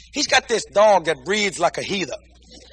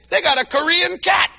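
excited shouting tale; 7 semitones higher than usual. Real, not acted. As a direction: shout it out like a big church punchline. Telling of the slapping cat.

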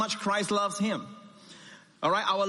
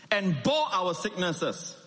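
ordinary talk, nothing marked

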